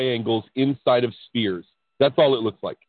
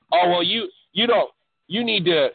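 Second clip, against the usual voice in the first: a hint of higher, preachy mockery; 4 semitones higher than usual. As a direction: parody the know-it-all advice. Mocking the enlightened crowd.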